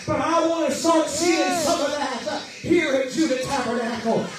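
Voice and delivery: fervent revival preacher building his voice to the climax; preaching at full force. Acted. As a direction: preach it out, rising to a roar.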